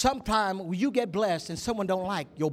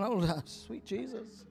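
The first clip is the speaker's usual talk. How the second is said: slow, soft imitated swoon; swooning awe; 8 dB quieter, much slower than usual. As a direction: sigh it softly and slowly in mock rapture.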